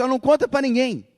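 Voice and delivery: loud, high urgent telling; Jesus says tell no one. Retold or imitated. Preacher voicing Jesus to the healed girl's family, loud and high. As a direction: call it out, urging silence. Jesus tells them keep quiet.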